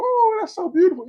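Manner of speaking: high sugary mock praise; fake praise. Parent praising a kid's crappy art, sugary and fake. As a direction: gush like a parent faking delight. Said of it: "you go".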